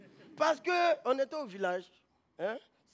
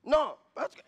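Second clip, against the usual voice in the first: ordinary delivery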